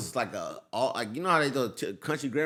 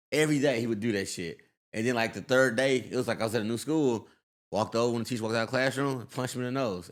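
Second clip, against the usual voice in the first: ordinary delivery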